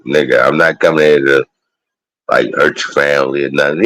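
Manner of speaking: ordinary delivery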